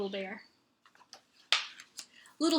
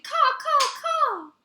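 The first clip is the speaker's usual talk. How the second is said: high, loud cawing crow; 6 dB louder, much slower than usual. A narrator's reading. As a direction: caw like a crow, high and sharp.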